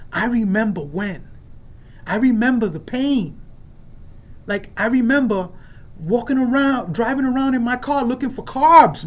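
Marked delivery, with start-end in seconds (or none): 2.1-9.1 impassioned testimony. Real, not acted. Passionate testimony at meeting.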